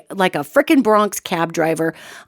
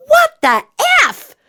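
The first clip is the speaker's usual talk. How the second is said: exaggerated mouthed curse; 5 dB louder than usual. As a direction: act out mouthing a curse through a car window. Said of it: "I mouth to her".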